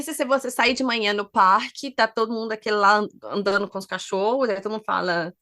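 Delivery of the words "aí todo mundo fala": usual speaking voice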